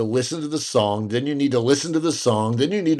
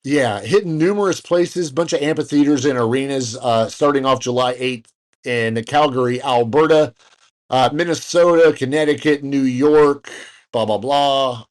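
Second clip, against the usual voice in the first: normal talking voice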